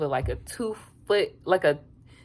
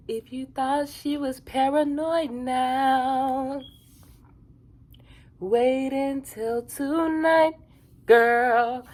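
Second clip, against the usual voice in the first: higher, slow, drawn-out warning; sassy read of notes; 7 semitones higher, much slower than usual. Retold or imitated. Reading notes to viewers, slow sassy warning. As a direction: draw it out like gossip. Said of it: "I put on here".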